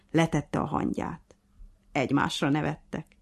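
ordinary delivery

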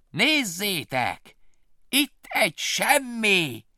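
high, loud, very slow taunt; jeering. Acted. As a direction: bats mock Willy together. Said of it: "hahotázták".

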